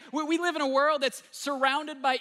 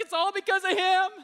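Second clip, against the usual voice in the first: excited; 10 semitones higher, 7 dB louder than usual. Retold or imitated. People marvel at creation.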